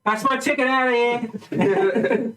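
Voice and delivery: high, excited proud father; overjoyed dad cheering, acted; enthusiastic dad. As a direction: whoop with pride from the back. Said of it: "one really enthusiastic dad at the back".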